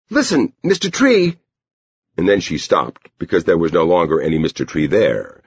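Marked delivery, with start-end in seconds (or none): 0.1-1.4 put-on high-pitched insistent girl; Edie. A narrator's reading. Girl tries once more to reach the man, high call. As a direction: pitch it way up to get his attention.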